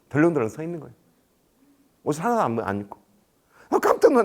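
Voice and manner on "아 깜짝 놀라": high, loud burst of surprise; startled exclamation. Retold or imitated. Official recalling finding a naked man, startled. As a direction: jolt out a retold gasp of shock.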